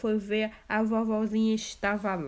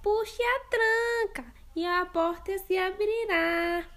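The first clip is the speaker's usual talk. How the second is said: put-on much higher old grandma; grandma's voice; 10 semitones higher than usual. Grandma telling the visitor to come in. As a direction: invite her in from bed.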